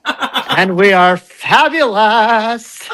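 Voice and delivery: lightly voiced serene instructor, slowing; soothing yoga teacher. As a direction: ease into it, slow and dreamy.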